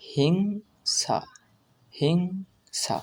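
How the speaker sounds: হিংসা slow, hing held, then sa; full pronunciation. Shown as a demonstration. Teacher gives the full pronunciation, হিংসা drawn out. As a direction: stretch the ng in হিংসা and separate sa. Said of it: "এর সম্পূর্ণ উচ্চারণ হবে".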